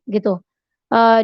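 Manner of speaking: normal talking voice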